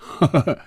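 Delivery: short, high acted laugh; pleased little high-pitched laugh; jovial chuckle. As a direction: cap the banter with a quick chuckle. Deputy boss laughs after his banter.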